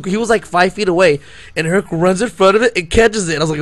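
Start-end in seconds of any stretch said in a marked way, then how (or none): none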